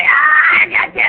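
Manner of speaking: loud high triumphant gotcha; spring the prank with a yell; gotcha shout. Retold or imitated. Revealing the fake injury to Knobs, loud gotcha shout. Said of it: "I was like".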